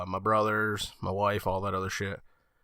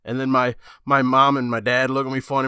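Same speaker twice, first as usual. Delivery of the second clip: ordinary talk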